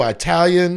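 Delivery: "Italian" with a long "eye" at the start; own pronunciation. Real, not acted. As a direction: say "Italian" as eye-TAL-yun. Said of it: "and I still say Italian".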